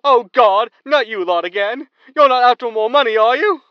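high, loud exasperated groan; exasperated host. Acted. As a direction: dread seeing them again, with a showman's whine.

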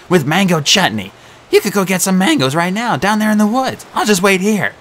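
selling himself as a meal; frantic satyr. A narrator's reading. Grover bargaining.

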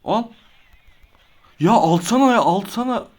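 loud, high, exasperated yell at the screen; frustrated shout. Real, not acted. Gamer yelling at the game to take the bait, loud angry shout. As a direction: shout it out in rage.

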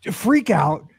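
ordinary talk, nothing marked

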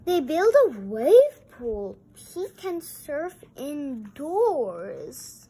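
put-on thrilled read-aloud to kids; excited. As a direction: read it like the best news ever. Excited reading of wave pool.

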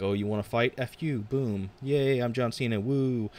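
hammed-up, sarcastic little victory whoop; John Cena. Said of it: "but then Cena's like".

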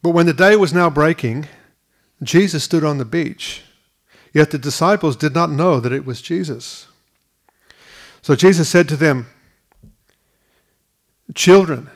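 measured, reverent reading of the verse; scripture reading. Acted. Preacher reading scripture aloud to congregation, measured and reverent. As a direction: read the Gospel to the congregation.

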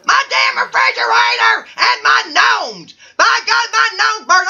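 high, hammed-up indignant outburst; ranting bachelor. Bachelor three on his house fire, high worked-up rant. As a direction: cry it out high, all worked up.

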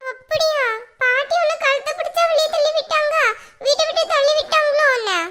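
put-on high, disbelieving little-kid questioning; incredulous child. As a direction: question it like a child who can't believe it. Child doubts mother's story about grandmother.

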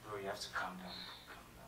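low, very soft aside; hushed restraint. Acted. Applicant restraining an impatient brother before the secretary, hushed. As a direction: quietly rein him in.

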